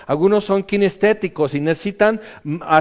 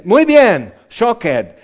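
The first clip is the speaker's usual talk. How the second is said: higher, louder praise; bright hammed-up "Muy bien"; mock delighted God; 5 semitones higher, 5 dB louder, much slower than usual. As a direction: cheer the student on. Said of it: "Y Dios dice".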